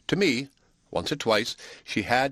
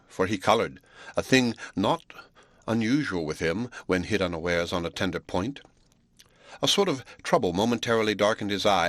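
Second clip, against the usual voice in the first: ordinary delivery, nothing marked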